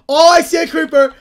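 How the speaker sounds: alarmed call. Real, not acted. Gamer warning teammates about a creeper, alarmed. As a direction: blurt out the sighting to the team.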